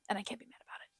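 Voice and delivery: a near-silent grumble to yourself; whispered aside. Real, not acted. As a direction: whisper it, mock-resigned. Whispered aside about concert.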